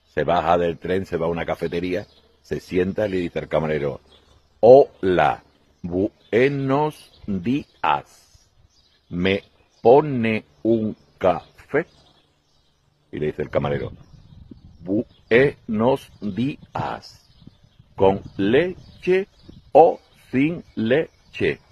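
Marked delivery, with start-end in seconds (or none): 4.6-11.9 very slow, drawn-out syllables; exaggerated slow speech. Spaniard in a Paris café ordering coffee, very slow. Speaking slowly so French understand. 14.8-21.6 very slow, drawn-out syllables; exaggerated slow speech. Spaniard in a Paris café ordering coffee, very slow. Waiter speaks slowly too.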